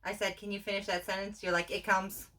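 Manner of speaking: ordinary delivery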